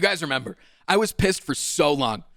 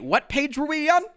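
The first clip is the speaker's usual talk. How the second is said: mock polite high voice; 8 semitones higher, 4 dB quieter than usual. Mocking the interruption on the phone.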